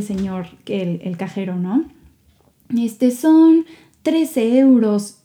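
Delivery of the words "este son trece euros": slow, drawn-out cashier quoting the price; lightly voiced shop cashier, slow and flat-footed; mock cashier voice. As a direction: play the bored cashier stretching every word.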